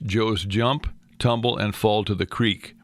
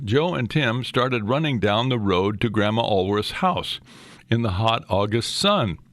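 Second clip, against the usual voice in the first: plain reading voice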